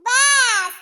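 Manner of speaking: one loud, drawn-out cut-off; exasperated outburst. Acted. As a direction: halt the excuses with a shout. Cutting off sister-in-law's excuses. (चिल्लाकर टोकना)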